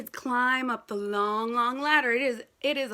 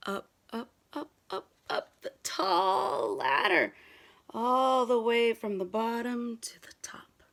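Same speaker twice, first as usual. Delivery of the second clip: awed whisper; 3 semitones lower than usual. Acted. Looking up the tall ladder.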